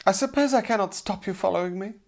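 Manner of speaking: high-pitched, grudging lady's voice; haughty damsel. A narrator's reading. Damsel tolerates her unwanted follower, haughty and high.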